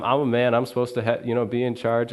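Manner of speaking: normal talking voice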